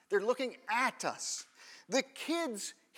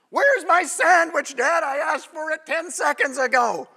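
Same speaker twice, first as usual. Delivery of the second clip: put-on higher and louder, an entitled child complaining; impatient kid; 5 semitones higher, 9 dB louder than usual. Preacher voicing his kid scolding Dad over a late sandwich, loud and impatient. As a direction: demand the sandwich like a spoiled kid.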